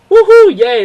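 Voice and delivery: high, loud celebratory whoop; excited cheer. Acted. Fan reacts to a cool plot reveal, cheering.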